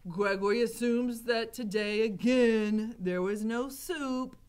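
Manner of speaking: slow, emphatic character voice; gruff jailer Gregory. A narrator's reading. Gregory to Mig about his tray, grumbling character voice.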